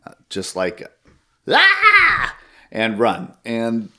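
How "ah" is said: sudden high, loud mock scream; short, piercing "Ah!" for comic effect; demonstrated scream. Shown as a demonstration. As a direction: scream like you're fleeing in panic. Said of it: "screaming and running".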